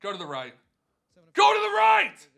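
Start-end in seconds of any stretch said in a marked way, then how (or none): 1.3-2.3 screamed high and much louder; desperate shout; real frantic yell. Real, not acted. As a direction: bellow at the wheel to land right. Urging the game wheel.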